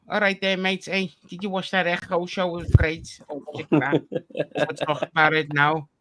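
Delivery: upbeat Liverpool Scouse accent; chirpy Scouse accent banter. Shown as a demonstration. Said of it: "I can't say it without using the Scouse accent".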